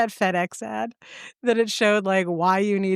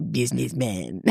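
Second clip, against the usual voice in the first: French sneer; 8 semitones lower, 4 dB quieter than usual. Retold or imitated. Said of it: "all I think is".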